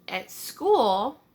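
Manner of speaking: normal talking voice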